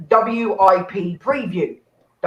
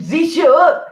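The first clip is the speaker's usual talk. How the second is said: higher, hammed-up German accent; bad German accent; 7 semitones higher, 4 dB louder than usual. MC repeating her botched line, exaggerated bad German accent. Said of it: "That's a shit German accent".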